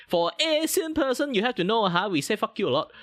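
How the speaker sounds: heightened VTuber voice. Acted. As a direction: push the pitch way up into the anime persona. Heightened voice, cursing joke.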